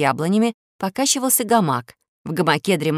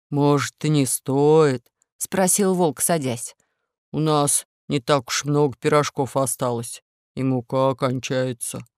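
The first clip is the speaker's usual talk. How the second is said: wolf voice; 4 semitones lower, slower than usual. Acted. Wolf objects.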